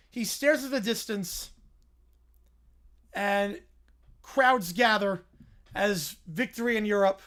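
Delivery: ordinary talk, nothing marked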